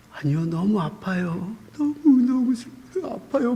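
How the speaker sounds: high, strained voice near tears, retelling the pain; pained pleading. Retold or imitated. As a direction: cry it out to God, softly broken. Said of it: "막 하면서".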